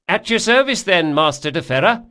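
high, loud, eager-to-serve rower; boatman character. Acted. Boatman taking leave of his passenger, high and loud. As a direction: sing out high and loud to the customer.

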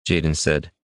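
ordinary delivery

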